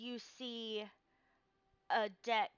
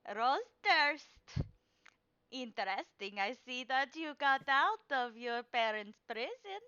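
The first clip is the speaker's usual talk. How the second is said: put-on old fortune teller; 4 semitones higher, 6 dB louder than usual. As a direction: savor the name. Madame Eva voice.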